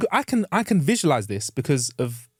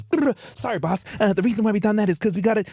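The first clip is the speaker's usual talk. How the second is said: higher, hurried, fumbling excuses to the boss; nervous underling; 10 semitones higher than usual. Pretend. An underling making excuses to the made man, higher and nervous. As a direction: stammer your excuses up at the boss. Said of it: "people will be talking to you bare words".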